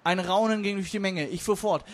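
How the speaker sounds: usual speaking voice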